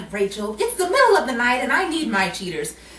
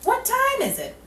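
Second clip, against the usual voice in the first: high, incredulous question; exasperated question; 13 semitones higher than usual. Acted. Betty asks the time in the middle of the night, high exasperated. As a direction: sound put upon.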